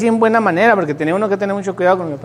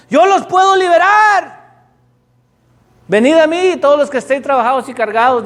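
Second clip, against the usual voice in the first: Jesus shouting; 5 semitones higher than usual. Acted. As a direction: call out to the captives at full voice. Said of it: "Jesús está gritando a todo pulmón".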